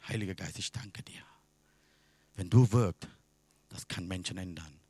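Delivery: very soft, low, whispered thanks; whispered prayer. Retold or imitated. Preacher recalling thanks to Holy Spirit, whispered prayer. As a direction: murmur it inwardly, almost without voice.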